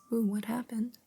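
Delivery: usual speaking voice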